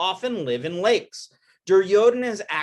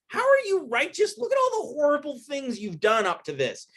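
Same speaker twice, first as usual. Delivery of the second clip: a hint of a scornful warrior's taunt; 8 semitones higher than usual. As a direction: taunt him, pitched up high. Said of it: "Arjuna taunts him and says".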